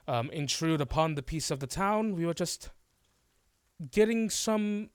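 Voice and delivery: smooth over it like a guilty showman; author excusing himself. Acted.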